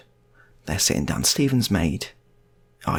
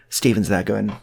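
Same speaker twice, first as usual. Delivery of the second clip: normal talking voice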